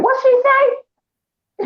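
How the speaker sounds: squeaky, slow, baffled falsetto; high confused relative. Retold or imitated. Relatives puzzled by his wife's accent, high mock voice. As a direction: stretch it out high like a baffled aunt. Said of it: "they like".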